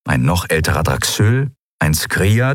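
ordinary delivery, nothing marked

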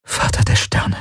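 whispered, voiceless invocation. A narrator's reading. Kirai invokes the Father of Stars, whispering. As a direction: whisper it under your breath. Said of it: "flüsterte sie".

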